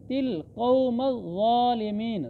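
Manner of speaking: ज़ालिमीन with a firm double z and long aa; slow recitation, drawn-out ज़ालिमीन; doubled, elongated sound. Shown as a demonstration. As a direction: lean into the z of ज़ालिमीन, then stretch it. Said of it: "ज़ा पर शद भी है और खड़ी ज़बर भी है".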